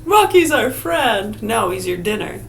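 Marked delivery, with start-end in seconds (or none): 0.0-1.4 put-on slow, higher, whiny little kid; childlike protest. Kid defending the pet squirrel Rocky, slow and whiny. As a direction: plead for the pet like a hurt child.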